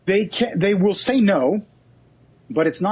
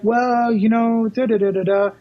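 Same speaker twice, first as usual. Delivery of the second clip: higher, dismissive homeowner hemming; homeowner brushing off; 5 semitones higher than usual. Retold or imitated. Homeowner at the door vaguely putting off the agent, dismissive.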